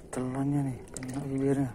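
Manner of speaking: usual speaking voice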